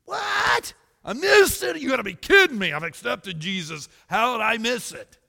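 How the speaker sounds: high shouted shock at missing it; panicked disbelief. Retold or imitated. Church member realising the rapture passed, shouting shock. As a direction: yelp it like you've been left behind. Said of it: "which I would have too".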